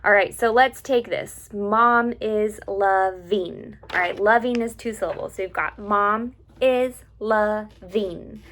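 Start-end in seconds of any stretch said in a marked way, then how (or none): 1.5-3.8 slow "Mom is loving", loving split lo-ving; syllable by syllable. Shown as a demonstration. Teacher laying out the lyric for students, syllable by syllable. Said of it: "one note to each syllable". 5.8-8.4 mom is loving slowly, in four separate beats; syllable by syllable. Shown as a demonstration. Teacher listing syllables of mom is loving, syllable by syllable. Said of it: "Those are our syllables".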